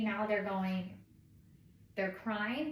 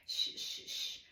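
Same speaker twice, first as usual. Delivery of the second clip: long soft voiceless "shh"; soothing shush; 4 dB quieter, slower than usual. Shown as a demonstration. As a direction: shush a baby to calm it.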